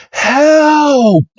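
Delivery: high, drawn-out mock yell; acted panicked call, pitched very high; cry for help. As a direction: imitate someone bursting in calling for help. Trainer bursts in calling for help.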